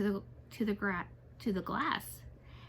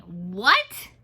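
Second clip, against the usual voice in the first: loud sudden "What?"; startled yelp; 8 dB louder than usual. A narrator's reading. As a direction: jolt awake in pain. Banging his head, yelping.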